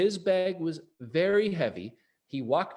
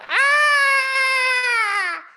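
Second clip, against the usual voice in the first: shrill startled scream, very high and loud; high scream of fright; 10 dB louder than usual. Acted. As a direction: shriek as if terrified. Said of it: "The lady yelled".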